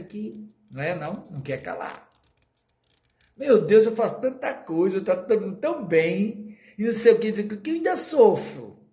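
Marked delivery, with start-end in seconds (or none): 3.4-8.8 a higher, louder lament of someone who suffers; voicing a sufferer. A lecturer voicing a troubled person who cries out to God, in a higher, louder voice. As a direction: cry out to God, half playing a troubled person. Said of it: "Perguntinha boa".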